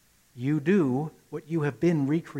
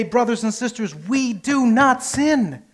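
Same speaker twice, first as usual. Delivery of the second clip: rousing declaration; 6 semitones higher, 5 dB louder than usual. Retold or imitated. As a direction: proclaim it to the whole congregation. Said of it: "and we say".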